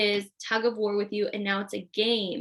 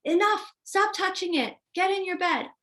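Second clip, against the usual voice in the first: high, sharp mock yell; imitating a yelling grandma; 7 semitones higher than usual. Grandma yelling at the child to stop touching, high mock yell. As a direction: snap at the child like a fed-up grandma. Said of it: "Grandma yells".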